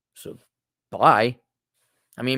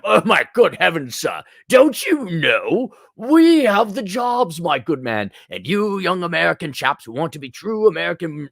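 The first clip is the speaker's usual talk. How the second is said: put-on snooty British accent; 4 semitones higher, 5 dB louder than usual. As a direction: ham up a stuffy British official. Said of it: "snooty British accent".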